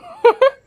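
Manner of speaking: sudden high burst of laughter; loud high laugh. Real, not acted. Laughing at a remark.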